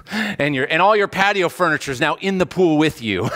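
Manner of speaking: usual speaking voice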